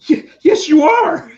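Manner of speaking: starstruck fan. Retold or imitated. A fan meeting Stan Lee, starstruck and cracking. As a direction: gush like a kid meeting Santa. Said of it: "like Ralphie talking to Santa Claus".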